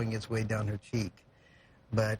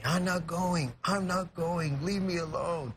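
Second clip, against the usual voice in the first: higher, half-hearted yell of refusal; imitating mom's frantic screaming, pitched up; retold scream; 6 semitones higher, slower than usual. Mom screaming at her kidnapping son, imitated as a pitched-up yell. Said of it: "she starts screaming".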